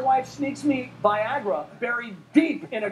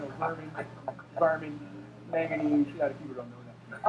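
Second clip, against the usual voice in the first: Carl Spackler mumble; 7 semitones lower, 7 dB quieter, much faster than usual. Retold or imitated. Carl Spackler impression, groundskeeper rambling.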